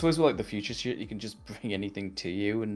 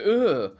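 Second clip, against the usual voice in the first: loud, higher mimicked "ugh"; imitated Joker grunt; 8 semitones higher, 6 dB louder than usual. Fan showing the sound that gave away the actor, imitated Joker grunt. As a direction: throw out a villain's grunt. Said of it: "That sounds like a Joker".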